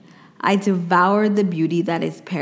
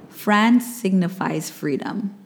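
ordinary talk

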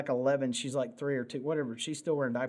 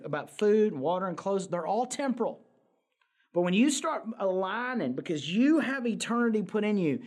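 fired-up preaching; 4 semitones higher than usual. Acted. Pastor urges the congregation to seek the kingdom, fired-up preaching. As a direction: drive it home to the congregation. Preaching builds to full force.

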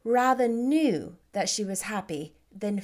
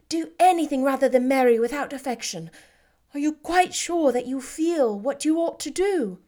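higher, louder sister's voice, lingering on very; Jane, delighted; 5 semitones higher, 4 dB louder than usual. Acted. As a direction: glad but checking her sister's heart. Jane urging caution.

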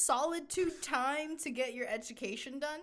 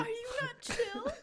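laughing; 11 semitones higher, 4 dB louder than usual. Real, not acted. Teasing through laughter.

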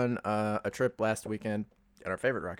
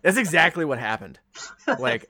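usual speaking voice

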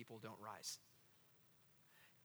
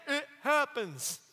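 Forrest Gump; 8 semitones higher, 7 dB louder, much slower than usual. Retold or imitated. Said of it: "The great prophet Forrest Gump said".

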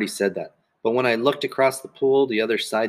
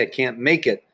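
ordinary delivery, nothing marked

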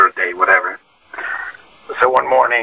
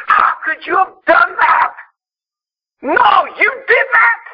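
high, loud, slow screaming outburst; retold scream; 3 dB louder, slower than usual. Mom hearing dad's confession, screaming. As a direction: shout it like a mother hearing a betrayal. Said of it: "would scream".

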